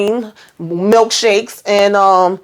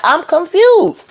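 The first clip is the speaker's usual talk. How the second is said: mock confused; 9 semitones higher, slower than usual. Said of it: "I'm saying".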